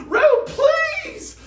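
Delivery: high, loud desperate yell. A narrator's reading. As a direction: scream his name, begging. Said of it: "Mad yelled".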